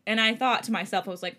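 ordinary delivery, nothing marked

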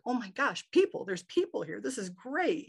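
high, delighted retold exclamation; thrilled to see people; excited. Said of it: "And I'm so excited".

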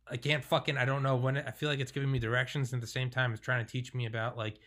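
usual speaking voice

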